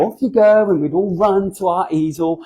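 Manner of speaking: ordinary talk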